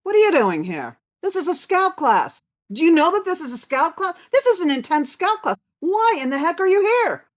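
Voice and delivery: high, loud, disbelieving bark; a gruff instructor demanding why she's there; incredulous instructor. Retold or imitated. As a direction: instructor protesting loudly at a student's arrival. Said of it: "he looks at me and he goes".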